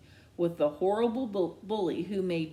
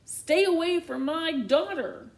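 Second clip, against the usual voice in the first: higher, louder warning over the shoulder; 10 semitones higher, 5 dB louder than usual. Acted. Mom warning the bully over her shoulder. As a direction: threaten him on the way out. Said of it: "tossing over her shoulder".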